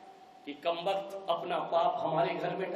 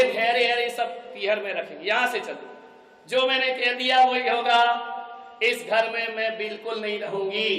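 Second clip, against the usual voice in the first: angry refusal; 3 semitones higher, 4 dB louder than usual. Acted. Daughter refuses her father.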